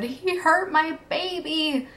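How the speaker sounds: high, drawn-out anguished cry, held back in volume; imitated mother's scream. Mom screaming to the neighborhood about her daughter, imitated. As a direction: wail it slowly, imitating a horrified mother. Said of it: "she just screamed in this, this sheer bloody murder".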